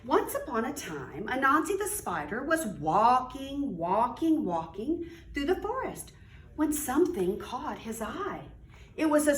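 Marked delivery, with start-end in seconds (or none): none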